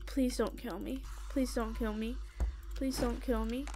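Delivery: small, frightened chant rising in pitch; hushed pleading. Real, not acted. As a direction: plead under your breath for your life. Begging the game's threat to spare them.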